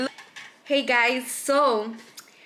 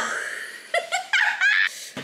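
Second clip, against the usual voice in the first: giddy squealing laughter; high shrieking laugh. Real, not acted. Kid bites the pepper.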